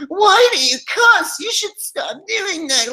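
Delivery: very high, drawn-out mock whine; mocking complainers. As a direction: imitate prissy critics in a squeaky, slow voice. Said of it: "So people are like".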